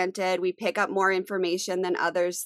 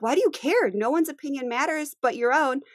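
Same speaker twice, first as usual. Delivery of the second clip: imitating husband; 7 semitones higher than usual. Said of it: "he's always like".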